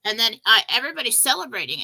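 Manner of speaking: ordinary delivery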